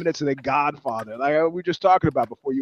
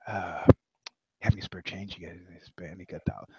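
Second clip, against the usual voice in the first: much softer, lower mumble; mumbled quiet request; 11 semitones lower, 12 dB quieter than usual. Retold or imitated. Homeless man asking for change, mumbled and very soft. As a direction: barely voice the ask for change. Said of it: "He's like".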